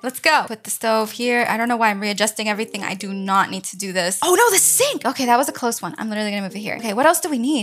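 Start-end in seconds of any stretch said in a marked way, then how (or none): 4.2-5.1 high-pitched sudden alarm. Real, not acted. Gamer almost forgets the sink in a timed build, alarmed. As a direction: yelp it as a last-second realization. Nearly forgot sink before timer ends.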